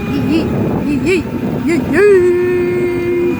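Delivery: loud, high whooping yee yee; excited whoop. Real, not acted. Kid fleeing a dog on his bike, whooping loud and high. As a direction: whoop it out while riding off.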